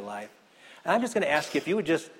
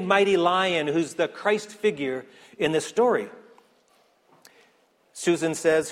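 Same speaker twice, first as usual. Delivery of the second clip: normal talking voice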